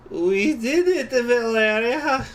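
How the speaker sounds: put-on slow, high, loud Russian-accented shout; shouted joyfully. Tipsy comrade shouting to Valeria, slow Russian shout. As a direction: cheer it drunk and proud in Russian accent. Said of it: "she shouted".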